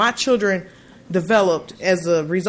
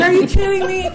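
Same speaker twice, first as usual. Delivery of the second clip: high, laughing amazement; laughing disbelief; 11 semitones higher, 3 dB louder, faster than usual. Real, not acted. Mother recalls kids' salads, laughing in amazement. Mother marvels at school food.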